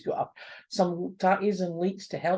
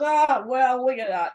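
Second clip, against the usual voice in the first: amused; 6 semitones higher, 7 dB louder than usual. Acted. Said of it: "great big belly laugh".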